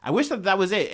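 ordinary talk, nothing marked

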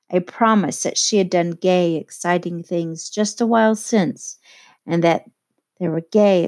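usual speaking voice

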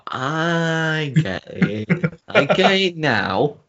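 high, very slowly drawn-out words; dawning realisation. Retold or imitated. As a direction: stretch it out as it finally clicks. Retelling moment the joke clicked.

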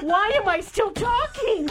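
high, slow, laughing through the words; laughing at herself. Retold or imitated. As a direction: crack up at your own nerve. Laughing at her own blurting.

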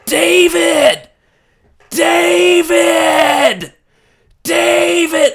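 loud, high drawn-out yell of a name. Retold or imitated. Wife yelling for husband from the men's side door, yelling. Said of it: "So she's just yelling".

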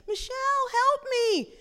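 high-pitched cry for help; acted desperate call to big sister; child's plea. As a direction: plead like a scared little brother. Little brother surrounded by bullies pleads.

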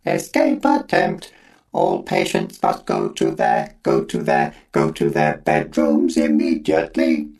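slow mechanical alarm voice; robot voice. A narrator's reading. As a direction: blat it like a stuck machine. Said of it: "a mechanical voice blatting".

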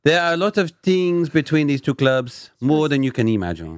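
committed, hammed-up French accent; put-on French accent, emphatic. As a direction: play the earnest French pundit. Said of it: "Really sticking with it".

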